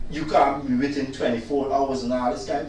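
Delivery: usual speaking voice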